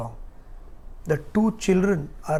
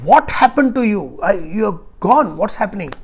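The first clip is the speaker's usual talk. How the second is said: alarmed wife; 2 semitones higher, 9 dB louder than usual. Acted. Wife finds husband collapsed.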